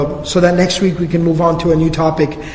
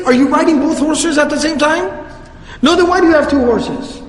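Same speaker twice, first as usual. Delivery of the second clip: higher and higher, disbelieving rant; exasperated scolding; 4 semitones higher than usual. Retold or imitated. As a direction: scold him in mock outrage, climbing up. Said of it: "But he said".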